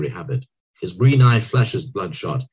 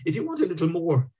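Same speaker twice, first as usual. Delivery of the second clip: appealing; 12 semitones higher than usual. A narrator's reading.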